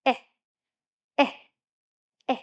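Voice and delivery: Eh as short e with cheeks lifted in a smile; short E sound. Shown as a demonstration. Teacher contrasting the egg sound with apple, smiling short e. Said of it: "make a half smile".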